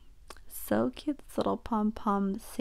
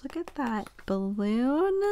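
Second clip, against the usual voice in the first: ordinary talk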